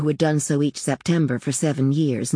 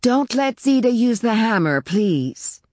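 raised, higher voice calling over; high call that settles on the please; mother calling out; 7 semitones higher than usual. A narrator's reading. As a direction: call the instruction down to the children. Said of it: "called the mother".

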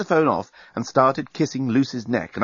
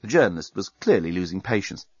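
plain reading voice

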